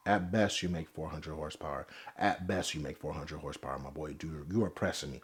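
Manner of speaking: hushed, deadpan put-down; near-whispered retort. Acted. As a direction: murmur it like a quiet put-down. Very soft, distant aside.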